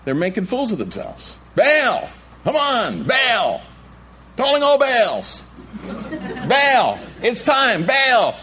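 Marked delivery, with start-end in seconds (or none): 1.5-8.3 high, hammed-up shouts to a god; mock calling out. Foolish worshippers summoning their silent god, high mock shouting. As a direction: holler for a deity who won't show. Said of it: "they called on the name of Baal".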